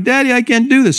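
high-pitched mock whine; whining, crying boy. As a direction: imitate a kid whining to his dad. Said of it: "he's whining and crying".

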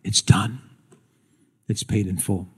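soft, low, solemn declaration; hushed cry of completion. Retold or imitated. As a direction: let it fall quiet and low, with finality. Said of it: "And he cried out".

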